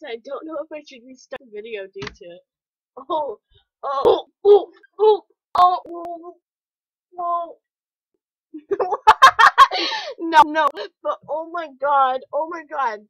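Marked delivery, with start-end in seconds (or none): 3.1-7.6 loud, alarmed oh-oh-ohs; shocked cries. Real, not acted. Reacting to disturbing fanart on screen, loud shocked cries. 8.5-10.1 loud, high burst of nervous laughter; shocked loud laugh. Real, not acted. After seeing a gross picture, a loud high nervous laugh.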